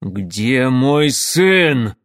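very slow, loud ringing demand; furious demand. Acted. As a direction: demand it, fury hiding fear. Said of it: "звенящим от явной ярости и скрытого страха голосом".

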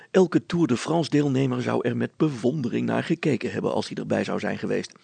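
plain reading voice

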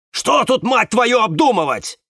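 high, loud angry outburst; yelling. Acted. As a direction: explode in exasperation, voice way up. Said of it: "Перестань орать". (сердито орать, срываясь вверх)

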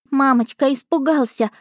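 put-on high little boy voice; small child voice. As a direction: answer like a shy toddler.

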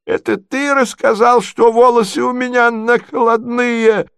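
very high, wounded questioning; doddering prince, betrayed. Acted. Prince accuses his nephew of betrayal, high and wounded. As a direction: ask plaintively in a high old-prince voice.

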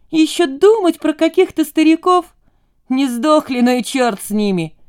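high, loud, scornful retort; dismissive girl. A narrator's reading. Romashka waving away thoughts of old folks, dismissive. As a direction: start high and indignant, settling as you shrug it off. Ромашка отмахивается от стариков.

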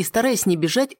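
ordinary delivery, nothing marked

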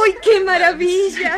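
laughing through happy tears; joyful laughing cry. Acted. Elisa finding her brothers alive, laughing with joy.

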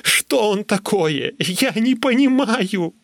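high, drawn-out cry of confusion; slow, anguished high wail; wailing despair. A narrator's reading. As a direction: cry out in helpless bewilderment. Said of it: "завопил я".

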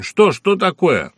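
startled fear at his friend's face; frightened. Acted. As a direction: Nashchokin asks Pushkin in alarm what happened. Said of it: "испугался Нащёкин".

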